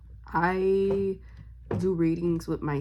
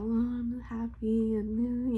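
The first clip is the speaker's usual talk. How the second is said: drawn-out, higher, airy-headed happy; woo-woo happy; 5 semitones higher, 4 dB quieter, slower than usual. Acted. Showing viewers how her mornings leave her, dreamy happy. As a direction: float through it slowly, blissfully woo-woo. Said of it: "like, all woo-woo".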